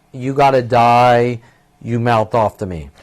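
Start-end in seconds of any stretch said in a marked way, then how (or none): none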